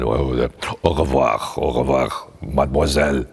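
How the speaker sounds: low-pitched French goodbye; deep boyhood voice. Acted. Twelve-year-old bidding his French teacher goodbye, in a deep voice. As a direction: sink the voice low, as a boy in French class. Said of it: "I was talking like this".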